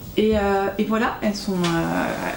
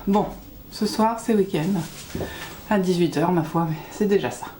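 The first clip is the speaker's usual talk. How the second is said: ordinary delivery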